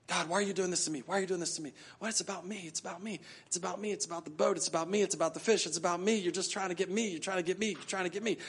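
hushed, repetitive mock grumbling; self-pitying complaint. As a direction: grumble softly on and on, acting sorry for yourself. Mimics self-absorbed complaining to God.

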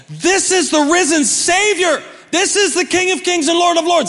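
high, ringing declaration to a crowd; public proclamation. Acted. Imagining Thomas declaring Jesus before a crowd, proclaiming. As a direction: proclaim it boldly, in an acted outburst. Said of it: "if he'd have said this in public".

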